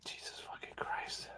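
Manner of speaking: very soft, whispered exasperation; whispered aside in disbelief; exasperated whisper. Real, not acted. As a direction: swear under your breath, barely audible. Exasperated aside over the played interview.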